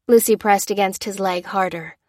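plain reading voice

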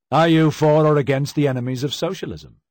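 demanding challenge. A narrator's reading. Husband confronting his silent wife at the banquet, demanding. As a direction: challenge loudly and press for an answer.

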